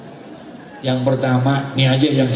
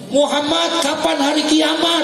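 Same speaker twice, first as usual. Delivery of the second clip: high, raised demanding question; acted questioner; 13 semitones higher than usual. Young man questioning Muhammad about Judgment Day, acted high call. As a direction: imitate the youth calling his question out high.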